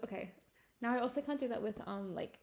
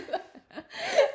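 high squealing laugh; 10 dB louder than usual. Real, not acted. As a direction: burst of high giggling. Silly conversation laughter.

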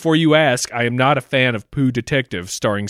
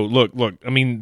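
ordinary delivery

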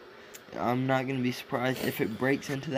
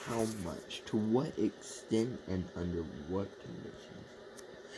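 softer, lower-voiced late-night murmur; talking quietly; 4 semitones lower, 7 dB quieter than usual. Real, not acted. Tired cohost listing questions at two in the morning, quiet. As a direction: say it quietly, almost to yourself. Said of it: "You're talking really quietly right now".

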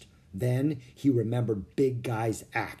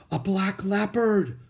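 breathed; 8 semitones higher, 4 dB louder than usual. Acted. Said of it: "breathed Jack".

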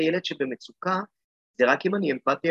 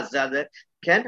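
ordinary talk, nothing marked

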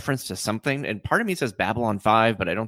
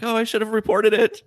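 self-reproach; 11 semitones higher than usual. Retold or imitated. Tendi voiced, self-reproach.

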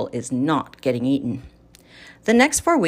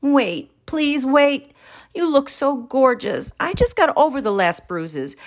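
higher, slowed pleading with his wife; begging; 8 semitones higher than usual. Acted. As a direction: beg her not to do it. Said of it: "I'm begging".

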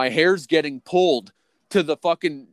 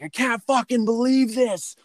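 high-pitched retold outrage; worked-up yell, pitched well up; losing it; 8 semitones higher than usual. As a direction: act out flipping out in disbelief. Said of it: "just losing my fucking mind".

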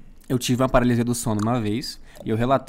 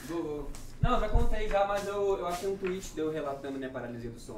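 distant, off-mic voice; speaking away from the microphone; far from mic; 4 semitones higher, 9 dB quieter than usual. Real, not acted. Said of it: "Conta perto do microfone".

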